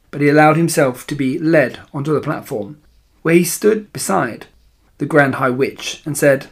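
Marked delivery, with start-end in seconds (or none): none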